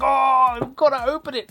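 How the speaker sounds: high excited squeal; itching to tear it open; eager excitement. Retold or imitated. Retold own eagerness.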